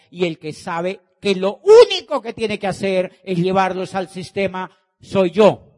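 slow, every soy yo hammered; emphatic build. Real, not acted. Telling the audience he owns the dream, slow and building. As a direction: build up, pounding each soy yo. Emphatic declaration of his role.

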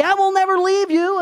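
high, loud emphatic vow; acted-out adamant pledge. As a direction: promise it insistently, pitched well up.

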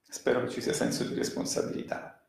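whispered repeat. Real, not acted. Commentator repeating his hope about responsibility to the audience, in a quick whisper.

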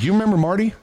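a lightly voiced mocking boss; mocking supervisor. Supervisor teasing the worker who reported, mocking.

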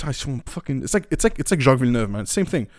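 normal talking voice

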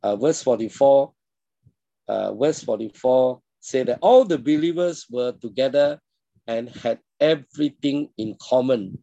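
ordinary delivery

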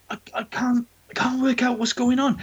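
normal talking voice

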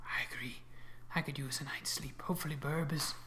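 soft unvoiced whisper. Real, not acted. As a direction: breathe the words, no voice. DM aside, whispered in-character talk.

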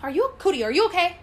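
mock fussing over someone; retold fussing concern. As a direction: imitate an over-eager helper hovering. Retold child fussing over pregnant woman.

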